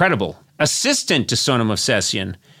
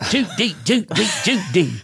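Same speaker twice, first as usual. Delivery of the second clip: high, sing-song Judy Judy Judy; Cary Grant impression for the guest; hacky Cary Grant; 8 semitones higher than usual. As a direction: do a cheesy old Cary Grant bit. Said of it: "that really hacky Cary Grant impression".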